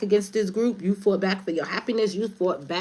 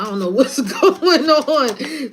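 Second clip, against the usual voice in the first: louder, higher, slowed by laughter; laughing through it; 7 semitones higher, 6 dB louder, slower than usual. Real, not acted. Retelling the King's reply, laughing out loud. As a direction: giggle your way through the line.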